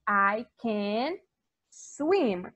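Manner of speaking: "swim" said clearly in English; English model. Shown as a demonstration. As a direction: model the word for kids. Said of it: "eu digo". (pronúncia clara de "swim")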